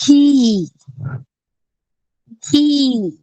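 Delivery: घी loud, its ee held long; long drawn-out vowel. Shown as a demonstration. Teacher models घ with long ई, loud drawn-out घी. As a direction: belt out घी with a stretched ee. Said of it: "घ को हम बड़ी ई की मात्रा लगाकर उच्चारण करते".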